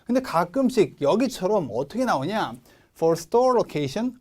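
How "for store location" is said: slow "For store location" with rising tune on location; rising intonation. Shown as a demonstration. Teacher modelling a list item, rising intonation on "location". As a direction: lift "location" at the end, drawn out. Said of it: "올려주시고".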